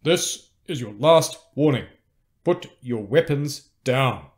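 slow, forceful, spaced-out command; stern final warning. A narrator's reading. Enemy commander warning cornered rebels, slow and stern. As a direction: hammer it out word by word.

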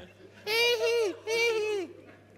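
high, loud squealing mock cry; hammed-up high wail; mock girl's high cry. Shown as a demonstration. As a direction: squeal like a crying girl. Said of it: "they cry much higher".

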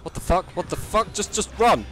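rising panic, high and loud; panicked shouting. Real, not acted. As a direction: yell it as the thing bursts in. Panic as monster appears.

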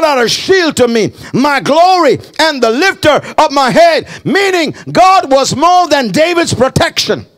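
high, forceful pulpit delivery; fired-up preacher addressing the church; impassioned preaching. Real, not acted.

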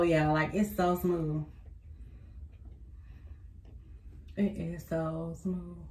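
talking while applying face mask; fingers at mouth. Real, not acted. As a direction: hand brushing lips.

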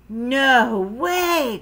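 loud, high, stretched refusal; crying out. A narrator's reading. Froggy refuses mom's play date, crying out. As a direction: refuse like an outraged kid. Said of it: "cried Froggy".

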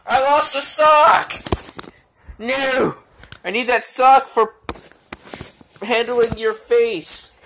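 high, loud hammed-up dismay; playful mock alarm; mock panic. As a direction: fake wail over a lost sock. Mock panic over sock.

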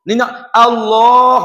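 slow "Allah" with the lam thick and heavy; slow, heavy "Allah" recitation demo. Shown as a demonstration. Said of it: "wi tebal kerana lafaz jalalah".

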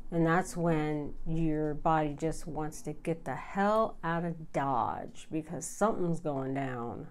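normal talking voice

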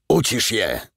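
curt, hard order at gunpoint; commanding order. A narrator's reading. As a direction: bark the command. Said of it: "rozkazał mu".

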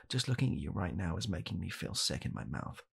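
usual speaking voice

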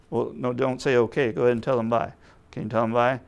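ordinary talk

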